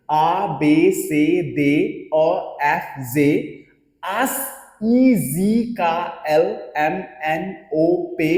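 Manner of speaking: आ बे से दे said very slowly, every letter stretched; French alphabet with feeling. Shown as a demonstration. Teacher models the alphabet with feeling for students. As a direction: recite with feeling, slow and deliberate. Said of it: "बिल्कुल feel लेके बोलो".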